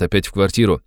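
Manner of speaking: plain reading voice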